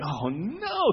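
high, drawn-out 'oh no'; mock dismay. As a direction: groan in comic dread, pitch soaring. Woken by barking dog, dismayed.

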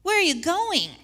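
high, loud plaintive whine; mock-whiny disciple pleading; whining Peter. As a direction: beg like a clingy child. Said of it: "starts whining".

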